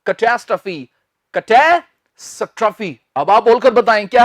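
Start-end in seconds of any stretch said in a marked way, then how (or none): 0.1-0.9 कैटास्ट्रॉफी as "kuh-TAS-truh-fee"; correct pronunciation. Shown as a demonstration. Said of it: "ये है". 1.3-3.0 slow कैटास्ट्रॉफी split as "kuh-TAE... struh-fee"; कैटास्ट्रॉफी in two slow teaching chunks; broken into parts. Shown as a demonstration. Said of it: "ये तो मैंने तोड़कर बोल दिया".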